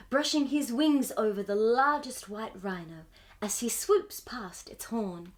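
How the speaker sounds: plain reading voice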